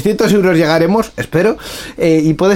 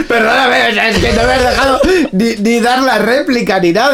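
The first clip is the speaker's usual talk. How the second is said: laughing; 6 semitones higher than usual. Real, not acted. Laughs while apologizing.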